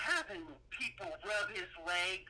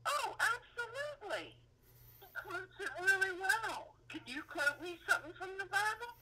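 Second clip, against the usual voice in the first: high squeaky voice; 12 semitones higher than usual. Acted. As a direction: gush about him in a squeaky voice. Mock-pious gushing about Trump.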